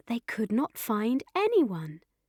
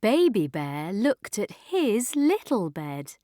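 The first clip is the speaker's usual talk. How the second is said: ordinary delivery, nothing marked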